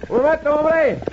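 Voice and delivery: high, loud hail; calling out. Acted. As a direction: call after a rider. Calling to a fleeing rider.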